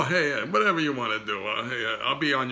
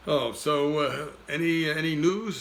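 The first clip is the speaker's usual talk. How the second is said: ordinary talk, nothing marked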